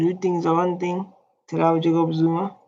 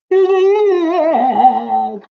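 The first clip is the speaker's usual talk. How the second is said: loud laugh; 9 semitones higher, 6 dB louder than usual. Real, not acted. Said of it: "laughing about crying teacher".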